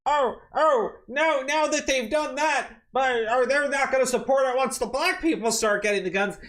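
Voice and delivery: high, loud sneering whine; mocking whiny voice. Streamer mocks critics backing off gun rights, in high whine. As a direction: whine it out like a hypocrite. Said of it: "they sit there and go like".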